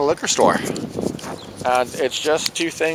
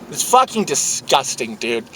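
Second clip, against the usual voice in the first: normal talking voice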